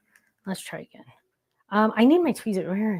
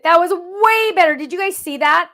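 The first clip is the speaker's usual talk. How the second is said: high, loud and thrilled; real excited outburst; 8 semitones higher, 9 dB louder than usual. Real, not acted. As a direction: exclaim it to the viewers in triumph. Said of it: "I get so excited".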